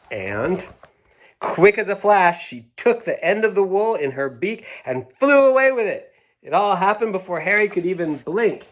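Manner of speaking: excited picture-book reading; reading to children. Acted. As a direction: make the swoop sound quick and exciting. Reads the story aloud to children.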